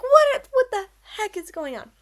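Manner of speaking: loud, high bewildered outburst; retold shock. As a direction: blurt it out in disbelief. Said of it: "and I'm like".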